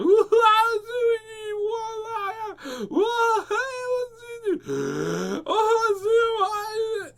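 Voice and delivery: high, loud screaming fall; hammed-up falling yell; long scream. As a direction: scream all the way down the slide. Screaming all the way down.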